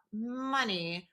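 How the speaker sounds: soft, drawn-out, doubtful echo; trailing softly into doubt; unconvinced. Retold or imitated. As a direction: repeat it slowly without really believing it. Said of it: "he doesn't really believe it".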